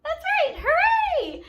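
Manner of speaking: delighted shout; excited cheer. Real, not acted. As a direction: burst out cheering with a laugh.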